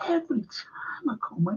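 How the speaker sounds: whispered, almost no voice; weary whisper. Real, not acted. To viewers about the itching, a tired whisper. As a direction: breathe it out, worn down by the itching. Complaining about itchy skin.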